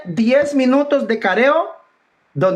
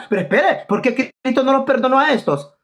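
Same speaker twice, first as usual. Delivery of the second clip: usual speaking voice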